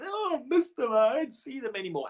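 high, whiny imitation of grieving folks; lightly hammed-up lament; a hint of a sad churchgoer whining; mock mournful sigh. Mimics grieving believers.

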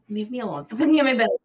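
mock snap at nosy people. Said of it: "I'm like".

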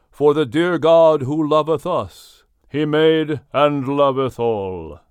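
slow, stately recitation; solemn poem recital. A narrator's reading. William recites his poem to the animals, slow and grand. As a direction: intone each line like a hymn.

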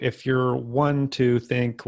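normal talking voice

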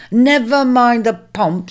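slow, firm and impatient; curt cut-off. Acted. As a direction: cut the boasting short. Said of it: "cutting him short".

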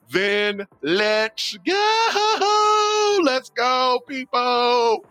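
high drawn-out shout; rallying shout. Real, not acted. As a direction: stretch out a rousing rally call. Pastor rallying the congregation.

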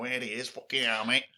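a loose imitation of a northern accent; greeting a mate in a Manchester accent. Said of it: "quite a good northern accent".

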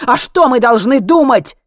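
high, loud, agitated challenge; shouted. A narrator's reading. Alex demands an explanation from Henry, shouting. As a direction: cry out the question in anger. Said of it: "выкрикнул Алекс".

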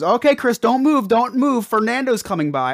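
high, urgent imitation of the dolphin guide; guide's warning. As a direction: mimic the guide warning Chris to keep still. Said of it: "he goes".

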